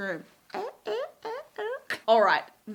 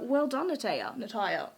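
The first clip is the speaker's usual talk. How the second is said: ordinary talk, nothing marked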